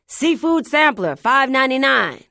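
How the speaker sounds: loud high hawker cry; street preacher shout. Acted. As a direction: bellow it like a street vendor. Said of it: "shouted angry things at passers-by".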